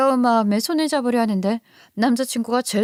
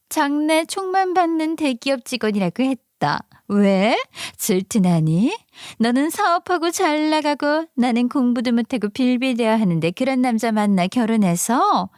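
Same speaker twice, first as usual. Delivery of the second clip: envious friend, pitched up; jealous best friend; 3 semitones higher than usual. Acted.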